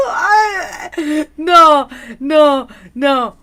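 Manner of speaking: high burst of laughter into loud no, no; real laughing shout of shock; shocked laughing cry. Real, not acted. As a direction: shriek with laughter then protest in disbelief. Shocked by a viewer's reveal.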